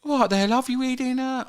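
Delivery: high-pitched mock British accent; impression of co-host's accent. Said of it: "I was gonna do you".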